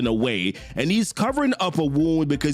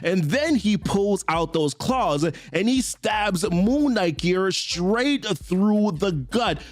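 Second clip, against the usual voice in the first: normal talking voice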